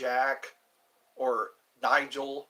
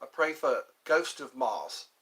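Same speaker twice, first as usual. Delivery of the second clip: lightly put-on British accent; mock British, matey and conversational; 10 dB louder than usual. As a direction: talk like a chummy Brit, playfully. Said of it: "How's my British accent?".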